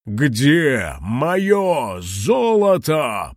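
very slow, drawn-out dragon roar in a strange voice; menacing dragon demanding his gold. Acted. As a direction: boom it out like a monstrous dragon. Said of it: "проревел кулон".

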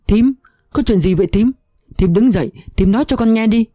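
frightened pleading, the voice gone strange; voice not her own. A narrator's reading. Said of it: "Tôi không nhận ra giọng nói của mình". (giọng lạc đi vì hoảng sợ)

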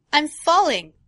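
high, loud panic; panicked cry. Acted. Boy cries to the crow while falling, high loud panic. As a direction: cry out in terror as you drop.

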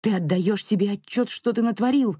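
furious, high-pitched demand; angry yelling. A narrator's reading. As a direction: yell it like an enraged mother scolding. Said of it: "заорала она, вытаращив безумные глаза".

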